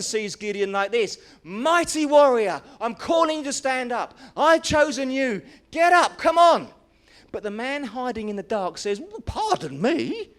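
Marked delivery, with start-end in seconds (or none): 1.4-6.7 louder and higher, building to a shout on get up; rally him to his feet; rousing shout. Acted. Heaven calling the hiding Gideon to rise, building to a shout. 9.0-10.3 incredulous pardon me. Acted. Gideon's incredulous reply.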